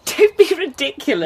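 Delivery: a high laugh into a playful scold. Acted. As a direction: laugh him off, flirting. Flirting from the window.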